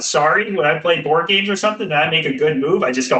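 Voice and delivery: ordinary delivery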